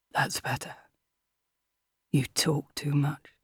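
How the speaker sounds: low, hushed masked villain; quiet menace. A narrator's reading. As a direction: murmur it low to a helpless victim. Masked villain addresses gagged victim.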